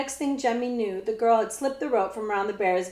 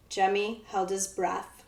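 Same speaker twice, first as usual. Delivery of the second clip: ordinary delivery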